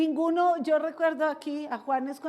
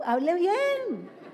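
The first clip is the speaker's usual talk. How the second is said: slow, higher exasperated correction; mock scolding; 4 semitones higher, much slower than usual. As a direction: scold the youngster, stretching the words. Scolds youngsters for silly voice. (regaño alargado y en broma)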